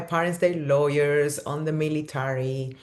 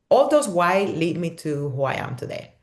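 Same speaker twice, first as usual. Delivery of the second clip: usual speaking voice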